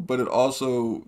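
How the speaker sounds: ordinary talk, nothing marked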